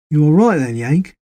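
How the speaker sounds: concerned English soldier checking on his mate; British soldier voice. Acted. Les speaks to Will.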